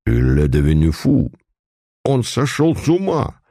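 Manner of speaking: officer giving up on the priest, despairing; despairing French cry. A narrator's reading. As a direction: throw up your hands in despair. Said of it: "с отчаянием в голосе воскликнул по-французски".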